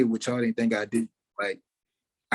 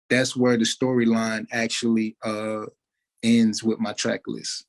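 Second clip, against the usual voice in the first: ordinary delivery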